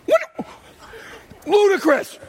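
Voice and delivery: high incredulous yelp; shocked. Acted. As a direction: yelp in disbelief. Outraged disbelief at the guests.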